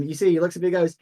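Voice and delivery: normal talking voice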